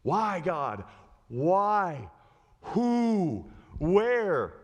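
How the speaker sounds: acted cry of questioning, word by word; mildly exasperated prayer; pleading with God. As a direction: plead upward in short, separate questions.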